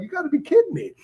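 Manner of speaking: high, incredulous retold disbelief; retelling a stunned reaction to sobriety news. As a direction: squeal out a stunned you gotta be kidding me. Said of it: "I was like".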